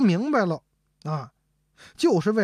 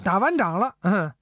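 usual speaking voice

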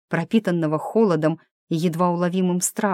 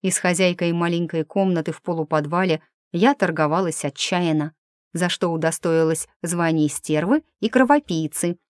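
ordinary delivery, nothing marked

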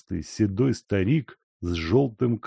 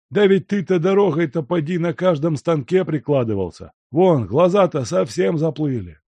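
put-on high, loud teasing old man; needling old servant; 8 semitones higher, 5 dB louder than usual. A narrator's reading. Servant teasing the guest about drinking, high and needling. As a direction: rib him about his drinking.

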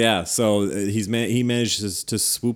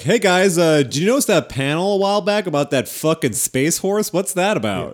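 casual dad voice; 6 semitones higher, 4 dB louder than usual. Retold or imitated. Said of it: "He's like".

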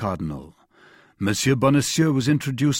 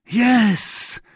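high-pitched exclamation of agreement; crying out; 9 semitones higher, 3 dB louder than usual. A narrator's reading. Prisoner suddenly recalling the circumstances, crying out. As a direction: cry it out, pitch jumping up. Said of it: "cried Bonacieux".

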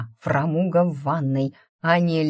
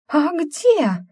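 put-on high, loud, indignant girl's yelp; 12 semitones higher, 6 dB louder than usual. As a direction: shriek it, confused and outraged. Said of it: "возмущённо растерянный девичий вопль".

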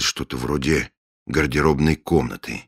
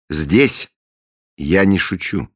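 stern, louder reply; 5 semitones higher, 5 dB louder, slower than usual. Acted. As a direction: say it firmly, no joking. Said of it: "был строгий ответ".

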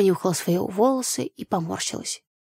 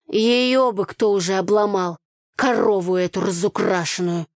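usual speaking voice

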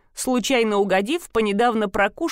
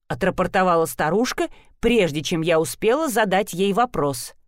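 plain reading voice

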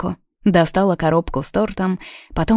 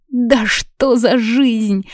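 slow, higher lament; exasperated exclamation; 6 semitones higher, much slower than usual. A narrator's reading. Hungover friends see only mineral water, exclaiming in dismay. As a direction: wail it out in exasperation. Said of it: "Дружно воскликнули Полли".

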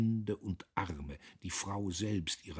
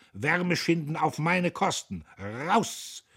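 loud, high-pitched angry scolding; angry snort; 11 semitones higher, 8 dB louder, slower than usual. A narrator's reading. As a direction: boss throwing the workers out. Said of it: "schnaubte er". (wütend schimpfend)

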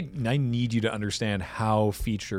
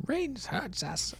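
high, slow, soft Gollum whine; 12 semitones higher, 11 dB quieter, much slower than usual. Retold or imitated. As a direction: whimper it like Gollum. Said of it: "sound like Gollum there".

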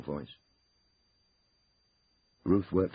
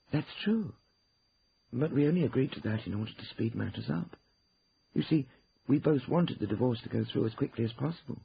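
higher-pitched voice of a client justifying herself; Ruth explaining herself; 7 semitones higher than usual. Acted. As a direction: explain it to the lawyers.

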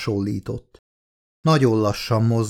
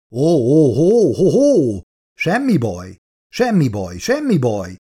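teasing. A narrator's reading. Milburn backs off from the creature, teasing. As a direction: taunt playfully, then coo it calm. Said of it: "incselkedett a férfi".

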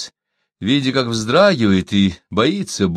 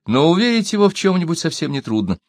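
plain reading voice

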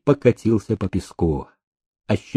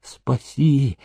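pleading; 7 dB quieter, much slower than usual. A narrator's reading. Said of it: "умоляюще протягивали руки".

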